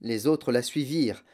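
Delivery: usual speaking voice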